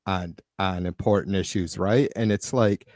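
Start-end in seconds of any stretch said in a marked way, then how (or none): none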